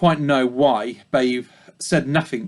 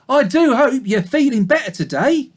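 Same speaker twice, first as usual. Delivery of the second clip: high, polite little pig; piglet voice; 10 semitones higher, 4 dB louder than usual. A narrator's reading. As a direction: keep it high and courteous. Babe speaks to ewe.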